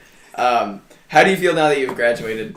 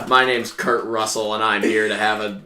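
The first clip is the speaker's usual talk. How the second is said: slightly lower, measured tough-guy impression; Kurt Russell; 5 semitones lower than usual. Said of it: "it's also my John Wayne impression".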